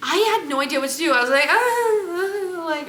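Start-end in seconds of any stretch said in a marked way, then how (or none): 1.5-2.7 high-pitched helpless "ah, ah"; mock panicked wordless dithering; flustered stammered "ah". Acting out being at a loss.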